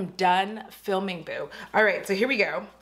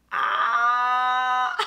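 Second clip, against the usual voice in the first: delighted shout and laugh; 4 semitones higher than usual. Real, not acted. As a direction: burst out with joy, then laugh. Delighted at palette.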